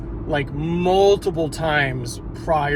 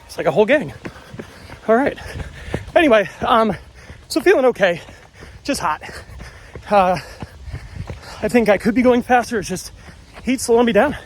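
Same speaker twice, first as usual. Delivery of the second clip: talking mid-run, a bit winded; while running. Real, not acted. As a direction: speak while jogging along. I'm holding this phone, talking to you.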